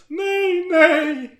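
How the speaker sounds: very high, wailing no; hammed-up tearful refusal; mock crying. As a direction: whimper it like a tearful girl. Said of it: "svarade Sara gråtandes".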